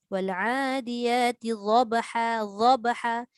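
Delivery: "Wal adiyati dhabha" slowly, the heavy dad held clear; slow recitation. Shown as a demonstration. Ustaz recites the example verse to show dad, slow. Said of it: "Dad dengan za ni dekat-dekat".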